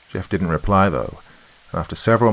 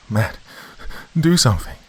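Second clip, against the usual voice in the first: high-pitched frightened plea; pleading; 7 semitones higher than usual. A narrator's reading. Wife begs husband to act, high pleading. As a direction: beg for help, voice pitched up. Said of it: "Sheila pleaded".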